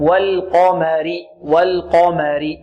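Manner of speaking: slow والقمر, thin l and m round a full q; measured والقمر, heavy qaf, light meem; light lam, heavy qaf. Shown as a demonstration. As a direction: thicken just the ق of والقمر, slowly. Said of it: "فنرقق اللام ونفخم القاف".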